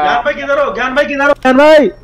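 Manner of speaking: high-pitched call building to a shout. Real, not acted. Looking for a missing teammate, calling out.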